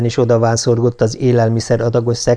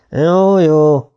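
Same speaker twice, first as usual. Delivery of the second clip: just-woken grudging reply, pitched up; bleary; 5 semitones higher, 3 dB louder than usual. A narrator's reading. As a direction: answer as if barely awake. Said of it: "mondta Beck csipásan". (álmosan, csipásan)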